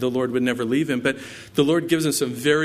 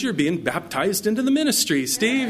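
imitating a pastor's laughing, higher-pitched reply; amused laughing retelling; 9 semitones higher than usual. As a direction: tease him through a chuckle, pitched up. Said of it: "he laughed at me".

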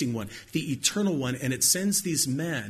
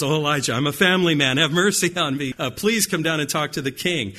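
pleading captain; 2 semitones higher, 6 dB louder than usual. Retold or imitated. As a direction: beg for your life, a frightened family man. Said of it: "this time it's a little bit different".

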